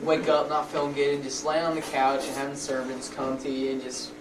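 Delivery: usual speaking voice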